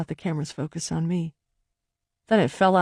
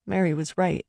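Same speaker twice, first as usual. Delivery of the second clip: plain reading voice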